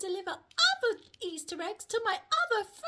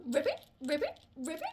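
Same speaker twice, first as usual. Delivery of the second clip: frog croak imitation; 6 semitones lower, faster than usual. Relaying Butterfly's clue about a hopping friend, frog imitation. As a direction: lower "ribbit" flicking up at the end. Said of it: "Do you know who might hop".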